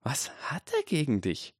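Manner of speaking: put-on high, hushed girl voice; lightly whispered high question; Granger. A narrator's reading. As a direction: ask it high and half-whispered. Granger's line, audibly high and breathy.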